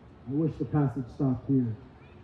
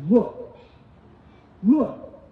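Look, look snapped louder and higher; disrespectful son; 5 semitones higher, 5 dB louder than usual. Retold or imitated. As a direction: bark Look at his father rudely. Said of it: "He goes".

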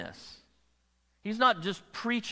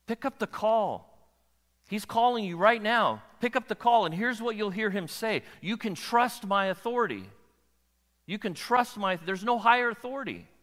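higher, louder preaching; full-force preaching; 6 semitones higher, 3 dB louder than usual. Acted. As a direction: urge them to answer the call. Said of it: "preaching at full force".

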